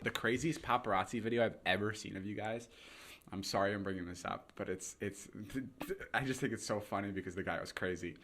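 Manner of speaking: ordinary talk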